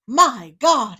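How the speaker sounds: shouted in shock; loud exclamation. Acted. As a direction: blurt it out loud. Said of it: "exclaimed the tailor".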